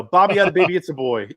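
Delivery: teasing sing-song shout. Retold or imitated. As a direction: belt the joke out to tease her brother. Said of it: "I go".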